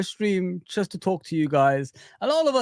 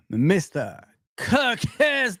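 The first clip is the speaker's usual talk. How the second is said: ordinary talk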